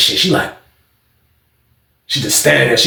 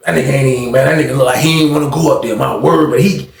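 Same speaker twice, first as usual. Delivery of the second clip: usual speaking voice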